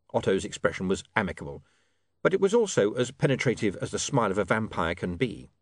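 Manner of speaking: ordinary delivery, nothing marked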